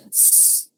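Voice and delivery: hard unvoiced hiss of S; forceful voiceless S. Shown as a demonstration. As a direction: push the S out as strongly as possible, no voice. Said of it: "por más fuerte que yo pueda articular".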